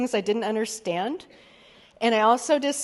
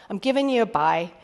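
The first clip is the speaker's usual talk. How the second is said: ordinary talk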